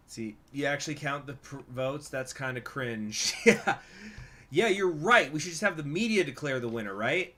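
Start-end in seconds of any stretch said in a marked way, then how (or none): 3.5-7.3 loud, high mock enthusiasm, easing off; sarcastic agreement. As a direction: pretend to agree wholeheartedly. Mock agreement about counting votes.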